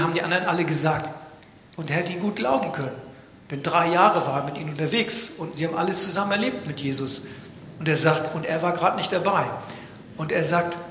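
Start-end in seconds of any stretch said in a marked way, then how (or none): none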